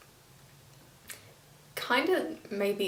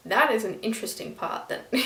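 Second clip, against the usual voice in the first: ordinary delivery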